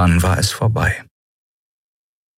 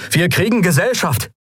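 calling out; 10 semitones higher than usual. A narrator's reading. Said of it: "rief Kai".

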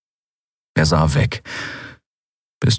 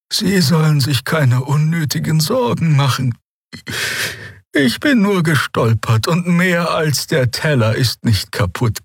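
langsam und hoch, eindringlich; ernste alte Draxyl; 9 semitones higher than usual. A narrator's reading. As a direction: ihn ernst zum Versprechen drängen. Xea bittet ernst. (ernste Bitte der alten Heilerin)

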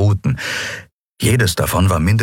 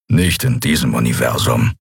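low grumbling retort; deep-pitched grumbler; gruff Keru; 6 semitones lower than usual. A narrator's reading. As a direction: drop low and rumble the reply. Keru's gruff retort in story.

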